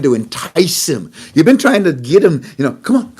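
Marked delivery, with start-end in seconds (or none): 2.8-3.1 mock playground taunt, pitched higher; Iran's mock schoolyard dare to Trump. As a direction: goad him like a kid daring a rival. Voicing Iran taunting Trump.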